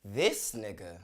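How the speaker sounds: low, falling, unimpressed punchline; unimpressed catchphrase. Acted. Comic capping a gripe about a seat neighbor, unimpressed. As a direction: drop the voice in disbelief.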